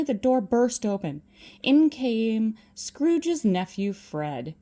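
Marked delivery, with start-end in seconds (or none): none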